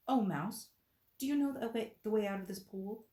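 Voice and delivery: high, loud call to the mouse. Acted. Alice calling to the mouse in the pool, high and loud. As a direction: sing out politely to a small creature.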